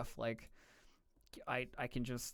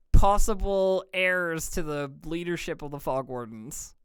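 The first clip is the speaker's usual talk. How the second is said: excited gushing; 5 semitones higher, 7 dB louder, slower than usual. Real, not acted. Fan gushing over two NPCs.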